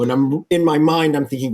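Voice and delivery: ordinary talk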